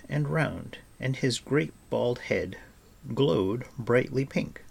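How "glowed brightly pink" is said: ordinary delivery, nothing marked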